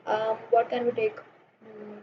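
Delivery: soft, low-key aside to oneself; thinking aloud. Real, not acted. Teacher hunting for an example to give, murmured to self. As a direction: murmur it while searching for an idea.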